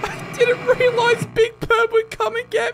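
high, loud terrified yelling; frightened scream. Real, not acted. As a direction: shriek from a jumpscare. Big Bird jumpscare.

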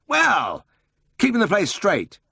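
high, lively voice for the bustling boss; brisk Siegfried. A narrator's reading. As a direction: play the eager older brother full of a plan.

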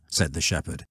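usual speaking voice

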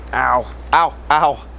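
sharp ows from a fresh cut; wincing through real pain. Real, not acted. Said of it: "it really hurts".

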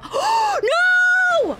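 sudden high frightened shriek; startled scream at a growl; panicked scream. Real, not acted. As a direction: gasp then shriek in real fright. Monster ambush in game.

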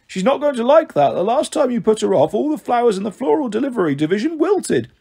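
high-pitched snake receptionist; female snake. Acted. Martha warns Hermes about the caller, in high snake voice. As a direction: warn the boss in a high character voice.

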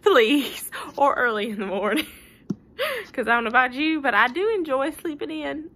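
laughing plea. Real, not acted. Joking plea to parents.

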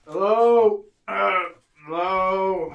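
loud shouted hello; calling out. Acted. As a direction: yell through the glass door. Banging on the cell doors.

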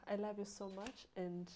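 usual speaking voice

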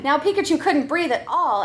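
ordinary delivery

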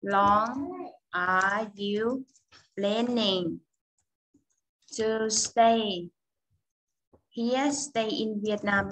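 teacher reading a question very slowly; slow model question. Shown as a demonstration. Teacher models the question slowly for her class. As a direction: spell it out word by word for students. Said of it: "mình có hỏi thêm một câu nữa".

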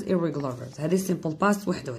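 ordinary delivery

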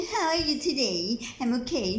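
mock the fake accent lightly; fake American accent. Shown as a demonstration. Wife showing the accent she won't use, mock American. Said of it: "ไม่ต้อง accent".

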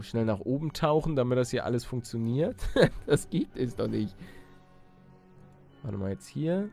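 ordinary talk

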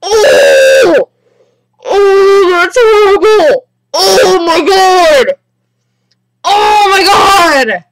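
loud, high, drawn-out cries; horrified wailing. Real, not acted. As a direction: wail in shock, stretching the words. Horrified by game scene.

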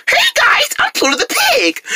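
very high, squeaky mocking piggy voice; mock the pig's cutesy greeting way up high. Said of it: "your annoying little cute piggy voice".

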